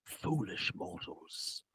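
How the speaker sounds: slow, menacing whisper; villain whisper. Acted. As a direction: breathe the threat like a dark lord. Villain hisses aside.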